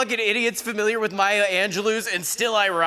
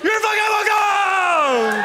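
high, slow, drawn-out shout of you're welcome; shouted catchphrase; 9 semitones higher, slower than usual. Acted. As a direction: belt it out like a victory cry.

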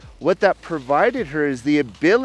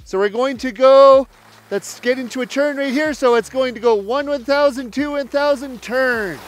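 raised, louder voice over the wind while skiing; live on-slope coaching; 7 semitones higher, 4 dB louder than usual. Real, not acted. Ski coach calling instructions to students mid-run, raised and loud. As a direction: call it out loud as you ski the drill.

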